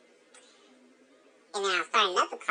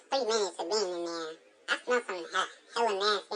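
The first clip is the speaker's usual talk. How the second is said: ordinary talk, nothing marked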